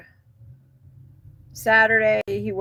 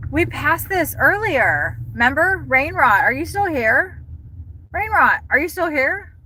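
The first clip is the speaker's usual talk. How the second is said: cutesy call; 8 semitones higher than usual. Acted. Calling to a pet-like thing.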